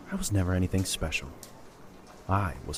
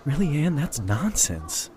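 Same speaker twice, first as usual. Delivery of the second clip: surprised disbelief; 6 semitones higher, slower than usual. Acted. Jacob disbelieving her self-doubt.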